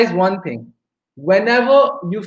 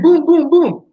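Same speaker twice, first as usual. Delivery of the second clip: punchy, higher booms, louder at the end; imitated hitting noises; 7 semitones higher, 6 dB louder, slower than usual. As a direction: sound out blows landing one by one. Imitates pan blows in story.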